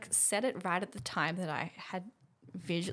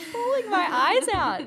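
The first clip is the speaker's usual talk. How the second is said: laughing disbelief; 12 semitones higher, 12 dB louder, slower than usual. Real, not acted. Recalling sudden crying.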